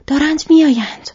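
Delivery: a sudden high, loud cry of warning; alarmed shout. Acted. Legolas warns the fellowship that they are coming, shouting. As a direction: shout the alarm as if the enemy is at the door.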